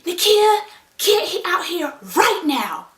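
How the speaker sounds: loud, high angry summons; drawn-out furious mom shout, retold; mom yelling. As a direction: act out a mother hollering down the hall. Angry mom summons her from room.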